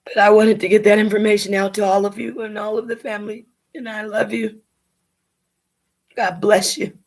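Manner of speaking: tearful, heartfelt sign-off; tearful goodbye. Real, not acted. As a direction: say your farewell while holding back tears. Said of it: "trying not to cry".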